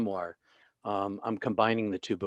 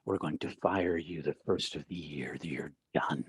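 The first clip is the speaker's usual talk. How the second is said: whispered threat; 5 semitones lower, 5 dB quieter than usual. Retold or imitated. Deacon to new minister before Christmas Eve service, whispering. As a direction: lean in and whisper it as a warning. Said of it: "leans in and she whispers".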